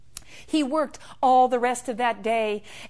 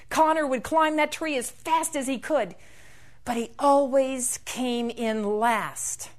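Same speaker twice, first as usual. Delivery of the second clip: ordinary delivery, nothing marked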